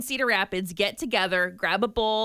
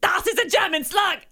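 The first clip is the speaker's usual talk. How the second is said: high, mock German accent; 7 semitones higher, 3 dB louder than usual. Jokey German accent bit.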